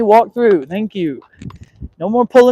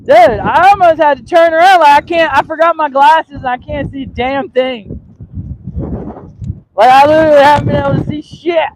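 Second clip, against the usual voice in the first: loud, raised-pitch complaint; exasperated venting; 5 semitones higher, 6 dB louder than usual. Real, not acted. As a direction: vent loudly over the wind. Venting about wind and sight.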